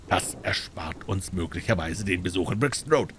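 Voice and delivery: plain reading voice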